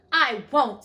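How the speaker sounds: very loud, firm refusal; defiant. A narrator's reading. Alice refusing the queen's order, defiant. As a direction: throw the refusal back loudly.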